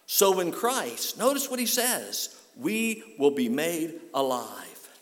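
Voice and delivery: preaching at full force; rising preacher's climax. Acted. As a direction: build the verse to a peak. Climactic preaching of Paul's words.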